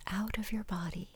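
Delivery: soft, slow, breathy whisper; guided meditation. Acted. Closing a relaxation exercise before the story, guided meditation. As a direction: ease them down with hushed, lingering words.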